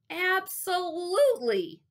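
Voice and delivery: high, stretched, loud burst of eagerness; a little too loud. A narrator's reading. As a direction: overeager boy, slow and too loud. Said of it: "I was just a little too loud".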